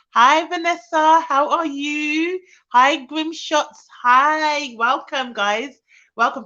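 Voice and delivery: loud, high, drawn-out hellos; gushing greeting. Real, not acted. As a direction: welcome each arrival with big, stretched vowels. Greets viewers joining the live.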